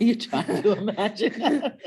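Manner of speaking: amused repeat; laughing echo. Real, not acted. Host repeating the girl's answer with a laugh. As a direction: echo her words through a laugh.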